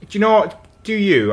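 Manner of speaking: normal talking voice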